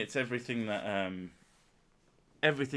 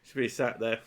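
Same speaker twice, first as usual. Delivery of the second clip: ordinary talk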